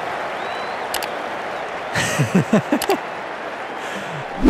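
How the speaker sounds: drawn-out breathy laughter; long laugh. Real, not acted. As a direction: laugh helplessly for a while. Laughing at batsman's mistake.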